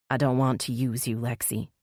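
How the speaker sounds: plain reading voice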